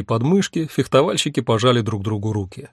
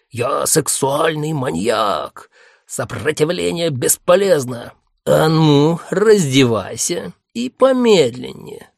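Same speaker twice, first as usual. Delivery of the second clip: slow hammy villain growl; cartoon villain voice; 3 semitones higher, 4 dB louder, slower than usual. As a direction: ham it up as a cartoon maniac. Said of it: "голосом злодея из мультика".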